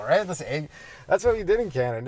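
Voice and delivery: normal talking voice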